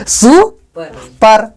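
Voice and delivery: सुपर very slow, सु with rising tune, pause, then पर; teacher splitting सुपर slowly; two separate syllables. Shown as a demonstration. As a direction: break सुपर into two beats with a gap. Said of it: "दो syllable हुआ".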